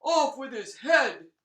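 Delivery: loud, high furious roar; angry Tsar roaring for the execution. Acted. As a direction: roar the order in rage. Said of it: "he roared".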